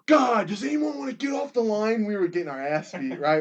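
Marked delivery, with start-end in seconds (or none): 0.1-2.0 high, loud frustrated coach shout; coach yelling. Retold or imitated. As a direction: yell at a losing team from the sideline. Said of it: "Coach Freeman was all like".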